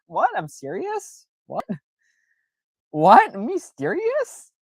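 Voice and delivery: high, squeaky questioning with rising ends; mock disbelief. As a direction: play up innocent astonishment, pitch shooting upward. Acting mock surprise at friends' remark.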